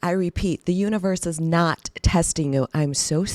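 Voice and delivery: ordinary delivery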